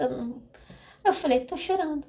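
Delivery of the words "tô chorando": tearful admission. Retold or imitated.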